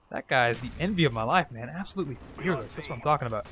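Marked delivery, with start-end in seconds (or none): none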